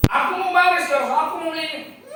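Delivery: put-on high, fast childish protest; protesting boy. Boy refusing to go home, high fast protest. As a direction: whine back like a stubborn kid.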